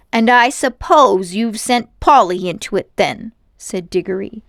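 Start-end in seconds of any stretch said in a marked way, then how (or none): 0.1-3.3 angry. A narrator's reading. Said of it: "cheeks were flaming with anger".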